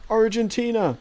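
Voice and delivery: high, loud goofy voice; high loud comic voice; weird voice for chat. Pretend. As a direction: blurt the name in a silly voice. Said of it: "read your voices aloud in a very weird way".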